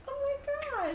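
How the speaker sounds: very high, thrilled voice; excited wife. Acted. Wife learning it is the general, high excitement. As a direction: gush high in a wife's excited voice.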